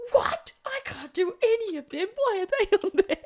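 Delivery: high-pitched, hammed-up dismay; mock disbelief. Colleague reading her own showcast accent list, high mock disbelief. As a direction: squeal it in mock horror at the list.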